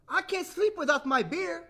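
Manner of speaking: put-on high, loud little-girl voice; complaining little sister. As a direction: complain like a small child. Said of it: "complained Little Sister".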